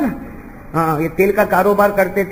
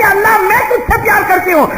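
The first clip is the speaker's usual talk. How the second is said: loud instruction; 12 semitones higher, 4 dB louder than usual. Acted. Master dictates proper prayer.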